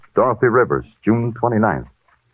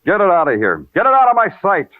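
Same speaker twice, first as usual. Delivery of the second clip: rising, louder angry order; sudden irritated shout; angry outburst; 12 semitones higher, 3 dB louder than usual. Acted. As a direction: shove the file away in anger. Detective snaps at sergeant.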